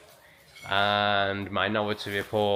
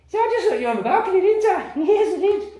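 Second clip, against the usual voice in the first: very high, fast, squeaky pet voice; puppy talk; 5 dB louder than usual. Real, not acted. Owner teasing the puppy about the balcony, very high and fast.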